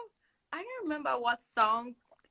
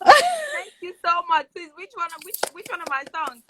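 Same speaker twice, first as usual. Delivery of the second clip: excited fan greeting; 7 semitones higher, 7 dB louder than usual. Retold or imitated. Meeting a star, greeting him with excited, high, loud gushing. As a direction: gush over a star you just met.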